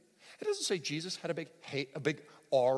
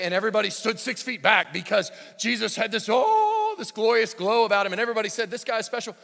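loud, high hammed-up telling of a glowing Jesus; mock grand proclamation; 8 semitones higher, 13 dB louder than usual. Preacher mocking a showy Jesus story, hammed-up loud. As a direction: oversell it as a parody. Mocks what the text doesn't say.

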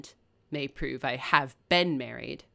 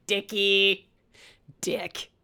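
mock Rochester; 5 semitones higher, 7 dB louder, much slower than usual. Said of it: "He's like".

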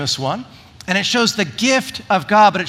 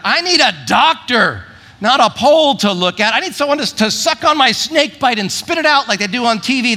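mock scoff; 6 semitones higher, 4 dB louder than usual. Skeptical Israelites voiced.

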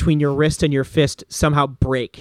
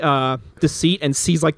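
normal talking voice

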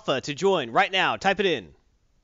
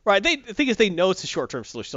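ordinary talk

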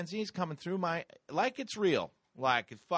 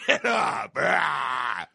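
gargoyle's wake-up yell; 7 dB louder, much slower than usual. Retold or imitated. Man acting the gargoyle rousing a sleeper, shouted yell. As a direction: bellow like a nightmare alarm.

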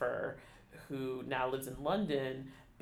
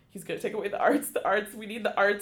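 higher, louder, rushed retold panic; worked-up plea; 7 semitones higher, 8 dB louder than usual. Recalling her panicked thoughts during the show, mounting distress. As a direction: build into an urgent retold plea for the arts. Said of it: "And I just start bawling".

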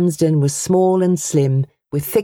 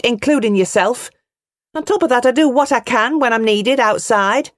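a much higher, raised woman's voice; proud angry mother; 6 semitones higher than usual. A narrator's reading. Mother defending her daughter to the bully, proud and angry. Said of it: "Pride and anger swelled her voice".

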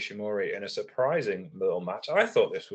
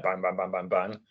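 "bang, bang, bang" fired off quickly, one after another; rapid bangs. Shown as a demonstration. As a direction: rattle off "bang" back to back with no gaps. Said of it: "a Noah kind of style of, like, match delivery".

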